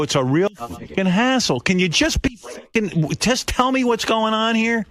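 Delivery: exasperated. Retold or imitated. Retelling yelling at Ronnie.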